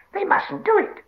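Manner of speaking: very high, loud screeched protest; frightened, angry Gollum. Acted. Gollum protests at Sam's fire, frightened shriek. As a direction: forbid it in a panicked creature shriek. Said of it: "both frightened and angry".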